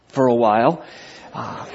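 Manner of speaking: normal talking voice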